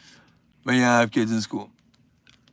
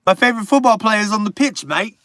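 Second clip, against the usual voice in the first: higher, louder mock British accent; bad British accent; 6 semitones higher, 5 dB louder, faster than usual. Streamer joking with a British viewer, bad British accent. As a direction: ham up a clumsy British bloke accent. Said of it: "that's a bad British accent".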